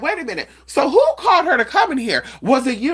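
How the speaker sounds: high, rising alarm; shocked gossip. Retold or imitated. Group members asking who called her, high shocked. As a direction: accuse the room in surprise. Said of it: "they were gagging".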